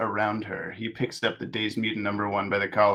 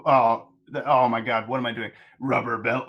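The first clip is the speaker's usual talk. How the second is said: normal talking voice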